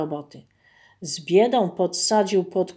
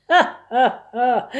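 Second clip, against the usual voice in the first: loud, higher ha-ha-ha, jeering; bear's mocking laugh; 4 semitones higher, 5 dB louder, slower than usual. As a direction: laugh at the fool like a bear. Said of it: "Więc niedźwiedź w śmiech". (szyderczy śmiech niedźwiedzia)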